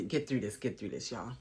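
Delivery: low, fading self-coaching while emotional; steady yourself softly, voice dropping away; struggling through emotion. Real, not acted. Vlogger trying to get through her tribute, emotional and subdued. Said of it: "let me try to".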